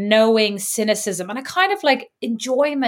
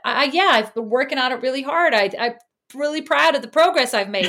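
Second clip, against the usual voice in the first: higher, eager imitation of sincerity; mock earnestness; 4 semitones higher than usual. American answers a compliment, mock-earnest pride. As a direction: play someone sincerely accepting praise. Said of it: "there's just an earnestness".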